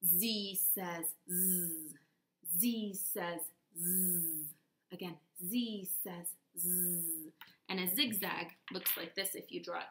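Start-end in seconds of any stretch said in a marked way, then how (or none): 0.1-7.3 slow, drawn-out "Z says zzz"; slow zzz demo; slow z drill. Shown as a demonstration. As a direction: stretch every buzz slowly for the kids. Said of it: "go ahead".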